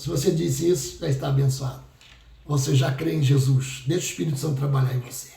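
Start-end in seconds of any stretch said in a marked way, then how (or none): none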